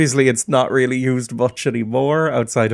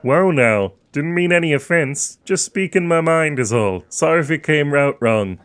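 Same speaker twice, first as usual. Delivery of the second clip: apologetic townsman; 3 semitones higher than usual. Acted. Townsman backs off.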